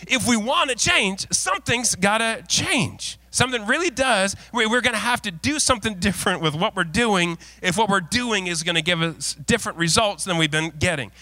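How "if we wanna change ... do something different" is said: preaching emphasis. Acted.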